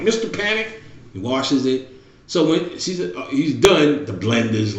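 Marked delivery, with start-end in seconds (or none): 0.0-0.8 put-on eager boy. Boy's name voiced high.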